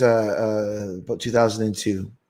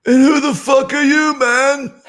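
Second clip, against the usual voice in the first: very high, loud falsetto impression; mocking high-pitched challenge; 5 dB louder than usual. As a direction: squeal it like a tickled old rocker, then chuckle. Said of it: "he walks by and goes".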